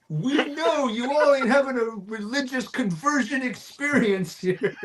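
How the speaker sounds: stern drill sergeant scolding; lightly voiced drill sergeant. Drill sergeants mocking recruits.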